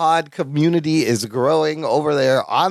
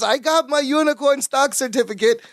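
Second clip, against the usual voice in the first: higher-pitched mock sitcom excitement; proudly announcing; 8 semitones higher than usual. Retells sitcom character's proud line.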